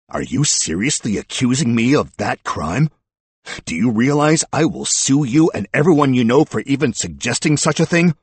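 loud, high-pitched indignant outburst. A narrator's reading. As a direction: protest so that everyone can hear. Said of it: "He raised his voice so everyone could hear him".

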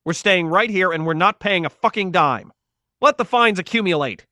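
high, loud and angry; defiant yell. A narrator's reading. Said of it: "he yelled".

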